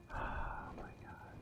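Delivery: slow breathless whisper; frightened hushed whisper. Real, not acted. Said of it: "My heart is racing".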